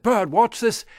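high, loud and proud; excited call. Acted. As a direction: show off to the bird.

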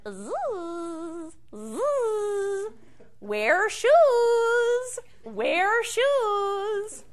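high, buzzing insect voice; buzzing bee voice. Acted. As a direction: buzz the words like a bee. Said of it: "the bee buzzed around his head and said".

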